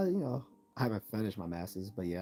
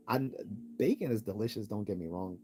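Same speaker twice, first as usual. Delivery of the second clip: ordinary talk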